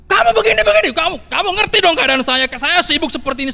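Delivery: put-on loud, high, angry yell at the child; angry father shouting. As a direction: snap at him like a stressed father. Father yelling back at child. (ayah membentak anaknya dengan keras)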